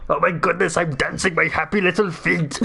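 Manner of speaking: high, hammed-up action-star glee; Arnold Schwarzenegger. Action star recast as dancing penguin, Austrian accent. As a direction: exclaim with a thick mock Austrian accent. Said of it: "I was doing Arnold Schwarzenegger".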